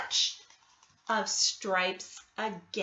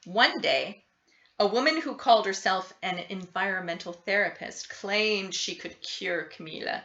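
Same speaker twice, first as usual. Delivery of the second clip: ordinary delivery, nothing marked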